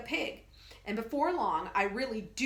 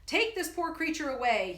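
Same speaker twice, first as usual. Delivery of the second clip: louder, solemn pronouncement; grand and solemn; 6 semitones higher, 4 dB louder than usual. Acted. Professor ending his pitch, grand and solemn. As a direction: announce it gravely. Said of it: "he says".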